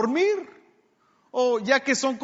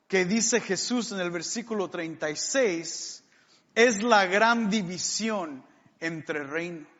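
usual speaking voice

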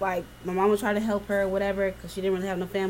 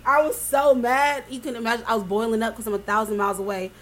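normal talking voice

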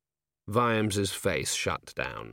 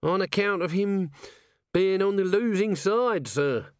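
higher, hesitant, nervous little corporal; raised wheedling voice, full of ums; Nobby; 10 semitones higher than usual. A narrator's reading. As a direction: stammer it out like a nervous underling. Nobby explains.

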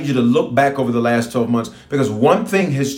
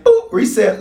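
God's playful reset; 10 semitones higher, 4 dB louder than usual. Retold or imitated. God voiced resetting things.

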